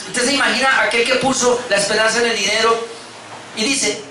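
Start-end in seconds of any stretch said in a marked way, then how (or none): none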